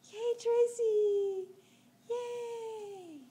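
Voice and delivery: high, loud cheering; excited cheer. Real, not acted. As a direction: cheer the little one on. Cheering for toddler.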